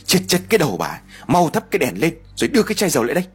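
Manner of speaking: a hint of an irritated husband snapping, fast; angry. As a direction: scold the wife and hurry her along. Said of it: "Ông Chiêu tức giận rồi nói". (tức giận quát vợ, nói nhanh)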